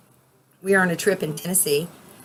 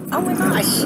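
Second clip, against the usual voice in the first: startled gasp; 12 semitones higher than usual. Real, not acted. Startled by a passing jet.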